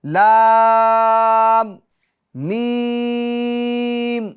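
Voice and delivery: لام and میم drawn out very long and sung; a long sustained لام and میم; letter recitation. Shown as a demonstration. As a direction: stretch لام then میم slowly like a chant. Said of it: "آگے چلتے ہیں".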